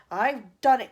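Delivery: loud triumphant cry; cried out. A narrator's reading. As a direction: announce it proudly. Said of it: "cried the chief financial advisor".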